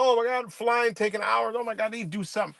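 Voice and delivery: high, fast whiny complaining, mocking the public; mock panic. A vlogger mocks upset travelers with a high whiny wail. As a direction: mimic a fussy traveler wailing about delays. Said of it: "they got everybody upset about".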